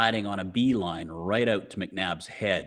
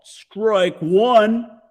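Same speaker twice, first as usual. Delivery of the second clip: high, loud umpire call; 12 semitones higher, 10 dB louder than usual. A narrator's reading. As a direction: call the strike across the field. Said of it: "McNabb called".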